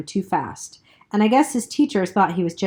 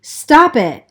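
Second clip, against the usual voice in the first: tight, higher, louder gasp through the teeth; gasped through clenched teeth; 7 semitones higher, 7 dB louder than usual. A narrator's reading. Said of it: "Johnny gasped from between clenched teeth".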